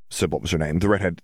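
usual speaking voice

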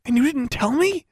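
high, hushed indignant question; shocked gasp. A narrator's reading. Girl learning friend kept a secret, gasping in shock. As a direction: squeak it out in disbelief. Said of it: "Anna gasped in her ear".

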